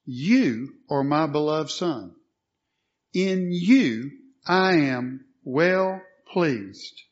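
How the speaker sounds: slow, emphatic declaration with "You" punched; spaced-out words, leaning hard on "You"; stress on You. Shown as a demonstration. Preacher voicing the Father to Jesus, slowly, with "You" stressed. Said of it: "notice the pronouns here".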